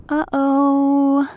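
high, friendly uh-oh; light, amiable concern; genial uh-oh. Acted. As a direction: kind dad's easy uh-oh.

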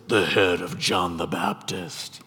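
low, slow, nasty villainess voice; ugly, evil woman. Acted. Preacher imagines Herodias demanding John's head, evil woman voice. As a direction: drag it out low like a wicked woman. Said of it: "In my mind, I always hear this".

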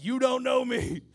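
loud, high playful holler; mock excited shout. Preacher imagining meeting the pastor in heaven, mock excited shout. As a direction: holler it as a gleeful hammed-up greeting.

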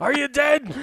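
high mock yell at a grave; yelling at gravestones. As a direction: call out shrilly to the dead. Repeats graveyard yell joke.

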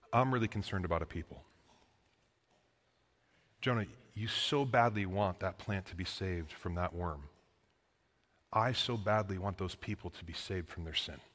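a father calling his son softly; loving teacher. Retold or imitated. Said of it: "coming as a loving teacher".

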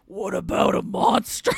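loud, growling monster voice; growly wrestler voice. Retold or imitated. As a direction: growl it like a big brute wrestler. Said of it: "puts on his growliest growly voice".

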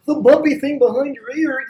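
high, loud mocking voice for the bumpy thing; dumb explainer voice. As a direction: squeak it out like a clueless teacher. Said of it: "They're not gonna be like".

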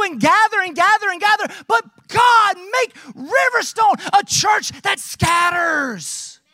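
high-pitched, driving preacher's delivery; impassioned preaching. Real, not acted. Preacher urging his church to scatter, impassioned preaching. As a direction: preach it out with rising fervor.

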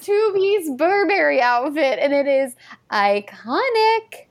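slow, drawn-out emphatic hype; all-caps emphasis. Acted. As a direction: stretch every word like it is in capitals. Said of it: "in all caps, I wrote".

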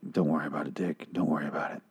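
low, quiet, lightly voiced brush-off; mock dismissive. Ford dismissing Bernard's worries, in a low quiet brush-off. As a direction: shrug him off under your breath.

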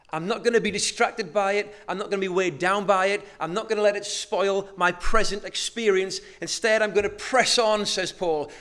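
loud, rising preacher's voice voicing Paul; fervent sermon voice, full chest; preaching at full force.

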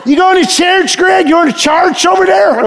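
mock his Eastern Kentucky kin calling out, laughing; Eastern Kentucky accent. Family back home asking Greg about church, in a laughing Eastern Kentucky accent. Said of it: "and that's how they talk".

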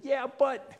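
very high-pitched mock objection; imitating an exasperated objector; whiny protest. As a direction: squeal a reluctant excuse. Acted reluctant protest to God.

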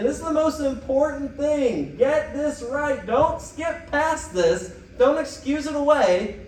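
high, slow, emphatic warning; urgent insistence. Acted. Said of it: "holding up one finger and you're saying".

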